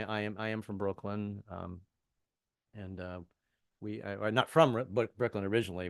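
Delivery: ordinary talk, nothing marked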